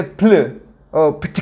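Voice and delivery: vowelless PL, then beatbox-like popping consonants. Shown as a demonstration. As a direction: spit bare consonants like a beatbox. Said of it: "sería como beatbox". (consonantes sueltas como beatbox)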